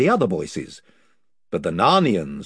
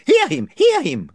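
higher eager followers; chorus of voices; 7 dB louder than usual. Acted. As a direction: cheer on your chief.

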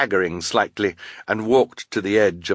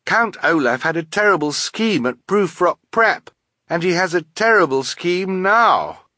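high, louder, urgent warning; emphatic raised character voice; warning boy; 10 semitones higher, 4 dB louder than usual. A narrator's reading. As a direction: insist on the danger. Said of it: "Klaus asked Carmelita Spatz".

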